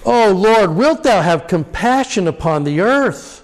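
high, pleading cry to heaven; anguished plea. Shown as a demonstration. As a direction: beg for mercy in anguish. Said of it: "you almost get always this".

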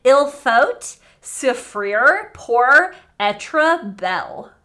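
loud, very slow French in a broad American accent. Shown as a demonstration. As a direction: butcher the French with hard American sounds. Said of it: "saying it in an American accent".

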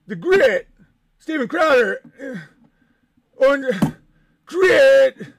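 mock pundit. Streamer mocking a pundit preaching grit, blustering mock voice. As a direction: ham up a tough-talk pundit.

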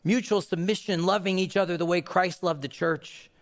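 ordinary talk, nothing marked

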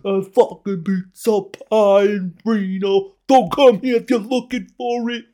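high, loud, ranting Reno local; mock angry local. Reno local yelling at a visiting New Yorker, mock furious. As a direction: bark it like a territorial local. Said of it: "I wouldn't be like".

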